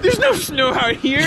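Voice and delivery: high, loud mock wail; a hammed-up distraught cry; mock crying neighbor.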